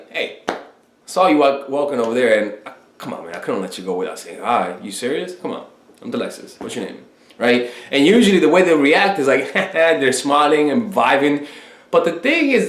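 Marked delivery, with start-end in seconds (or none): 0.1-7.0 low-key confident approach, trailing softer; casual, lower and unbothered; unafraid, cocky. Acted. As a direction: play it cool and sure of yourself. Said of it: "No, you're like".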